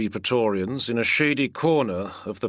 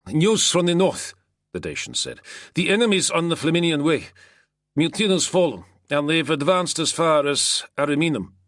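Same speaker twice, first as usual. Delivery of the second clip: higher, louder soldier voice delivering news; Dacian soldier's news; 8 semitones higher, 4 dB louder than usual. A narrator's reading. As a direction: announce the news eagerly.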